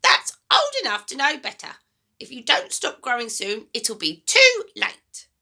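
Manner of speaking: put-on high, loud, bossy old woman; a mean grandma giving orders, high and loud; grumpy grandma voice. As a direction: nag the boy like a sour old granny. Grandma warns.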